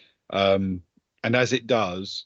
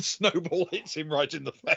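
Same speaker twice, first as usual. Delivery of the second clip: usual speaking voice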